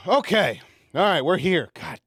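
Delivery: normal talking voice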